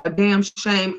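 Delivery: ordinary talk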